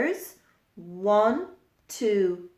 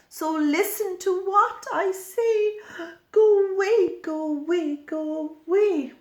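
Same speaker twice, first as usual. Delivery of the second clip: frightened go-away bird; 8 semitones higher than usual. Acted. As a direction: shriek it high to chase the hunter off. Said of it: "what do you think she said to the brown bird".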